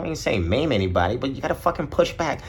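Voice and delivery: ordinary delivery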